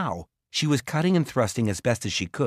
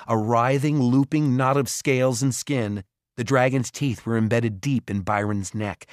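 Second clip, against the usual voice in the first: ordinary delivery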